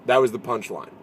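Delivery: usual speaking voice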